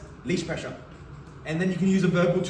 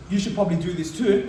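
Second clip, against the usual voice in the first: ordinary talk, nothing marked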